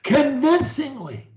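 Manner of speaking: "convincingly" pitched high and punched out. Shown as a demonstration. Coach modelling for a stutterer, high and convincing. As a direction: deliver "convincingly" with high, firm emphasis. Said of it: "thinking how you can say them convincingly".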